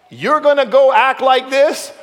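high, loud exasperated parent voice; scolding parent. Retold or imitated. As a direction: tell off a kid like a fed-up parent. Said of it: "every parent has asked their kid this".

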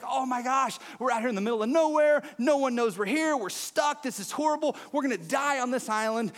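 hammed-up rising panic; freaking out. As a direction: play a castaway panicking in a joke. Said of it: "one of the guys starts freaking out".

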